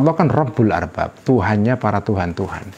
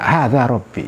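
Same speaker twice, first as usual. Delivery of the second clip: normal talking voice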